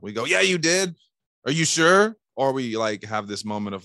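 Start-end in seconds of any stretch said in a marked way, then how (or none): 0.2-2.2 higher, louder argumentative pushback; mock-frustrated salesman challenging the lead; angry and frustrated. Salesman arguing with a lead on the phone, frustrated. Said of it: "angry and frustrated".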